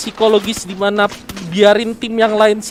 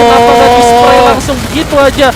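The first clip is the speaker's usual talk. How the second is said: excited; 3 semitones higher, 8 dB louder than usual. Real, not acted. Caster reacts to fight.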